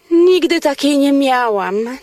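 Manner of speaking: usual speaking voice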